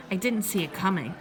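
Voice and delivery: usual speaking voice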